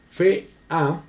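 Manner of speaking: "fe, a" broken into two distinct syllables; syllables separated. Shown as a demonstration. Teacher showing students the hiatus, syllables separated in "fe, a". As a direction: split "fe, a" at the vowels, pausing between. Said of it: "miren lo que pasa".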